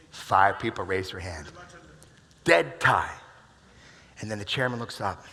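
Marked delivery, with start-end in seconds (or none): 2.4-3.1 dramatic announcement. Acted. Dramatic story climax.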